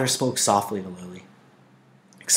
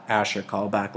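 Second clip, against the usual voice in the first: ordinary delivery, nothing marked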